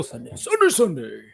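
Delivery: high, hyped radio ad shout of Sunday; mock ad announcer. Host joking to viewers, hammed-up ad announcer. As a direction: parody a monster truck commercial. Monster truck ad parody.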